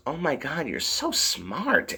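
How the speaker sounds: high, soft, gushing admiration; Madison. Acted. Hearing the survival rules, softly gushing imitation. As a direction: drag out the last words in mock awe. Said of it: "Madison goes".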